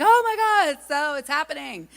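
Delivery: high, loud burst of mock excitement; excited gasp, voice jumping up; excited squeal. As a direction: squeal with delight at the idea. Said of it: "I was like".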